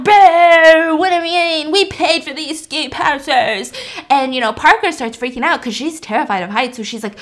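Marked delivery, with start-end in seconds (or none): 0.0-3.7 loud, higher booing, then slow complaining; mock crowd booing. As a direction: boo like an annoyed paying crowd. Crowd booing stuck lift.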